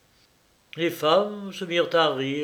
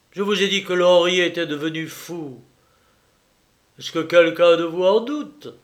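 put-on louder, bitter, defiant young man; Jacques accusing; 4 semitones higher, 6 dB louder than usual. Jacques accusing Clémence before the guests, bitter defiance. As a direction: challenge every guest.

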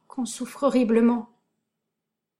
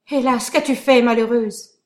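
anguished cry; 3 semitones higher, 8 dB louder than usual. Acted. Hernani cries out in anguish.

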